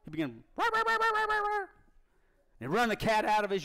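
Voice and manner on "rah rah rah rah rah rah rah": high, yapping little terrier bark; dog barking. Retold or imitated. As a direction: yap like a small fox terrier chasing a cat.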